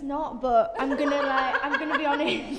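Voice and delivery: giggling while being honest; laughing. Real, not acted. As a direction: singer laughing at the interviewer's accent. Laughing at bad accent attempt.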